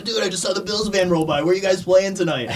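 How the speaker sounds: high-pitched, excited call-out; excited fan. Retold or imitated. Fan calling to the band about their Bills van, excited. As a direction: imitate a thrilled passerby hollering at the band.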